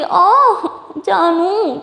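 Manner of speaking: high, drawn-out pouty moan; whiny complaint. A narrator's reading. Teased partner asks why she torments her, playful whine. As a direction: moan the pet name in mock hurt. Acted whining at being teased.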